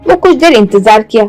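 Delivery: plain reading voice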